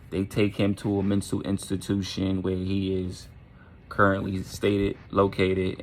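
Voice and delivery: usual speaking voice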